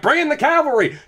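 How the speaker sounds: high-pitched mock rallying cry; Jamie. Jamie calling for help too late, mock rallying cry. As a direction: call it out high, as a hammed-up Jamie. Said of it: "Jamie's like".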